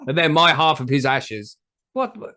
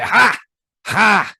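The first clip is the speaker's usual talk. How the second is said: gloating laugh; 6 semitones higher, 4 dB louder than usual. Pretend. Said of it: "And then be like".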